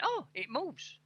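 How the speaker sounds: high, soft mock discovery; high-pitched little "oh" of surprise; acted surprise. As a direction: re-enact a sudden realisation. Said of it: "And now I went".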